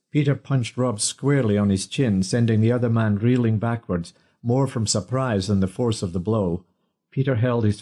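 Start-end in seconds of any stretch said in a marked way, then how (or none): none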